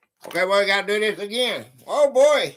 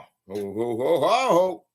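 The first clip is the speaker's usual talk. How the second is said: ho ho laugh; 4 semitones lower than usual. Acted. Santa-style laugh on stream.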